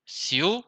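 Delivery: ciudad as a high rising ciu, pause, dad; split into syllables. Shown as a demonstration. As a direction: lift ciu in ciudad, then a separate dad. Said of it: "está en una sola sílaba".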